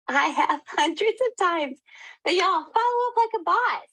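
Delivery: high, laughing pep talk; laughing hype. Real, not acted. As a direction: cheer them on through a laugh. Laughing, then hyped advice.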